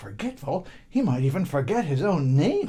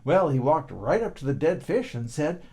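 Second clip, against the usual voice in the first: usual speaking voice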